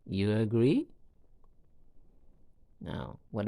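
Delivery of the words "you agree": "agree" rising sharply into a question; rising intonation. Shown as a demonstration. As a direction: make a yes-no question by lifting "agree" at the end. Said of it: "with a rising intonation at the end of it".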